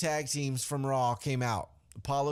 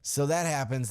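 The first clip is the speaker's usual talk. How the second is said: normal talking voice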